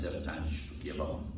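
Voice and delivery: usual speaking voice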